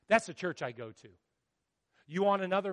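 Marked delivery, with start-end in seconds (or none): none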